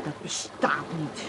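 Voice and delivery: hushed disbelief. Acted. Spying on beach, disbelief.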